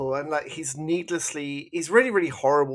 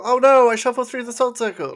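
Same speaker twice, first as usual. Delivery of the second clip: high, loud, hammed-up "oh no"; mock alarm; 10 semitones higher, 9 dB louder than usual. Mocking the character breaking the salt circle, hammed-up surprise. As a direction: fake panic, overplayed.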